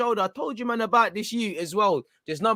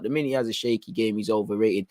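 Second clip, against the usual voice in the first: normal talking voice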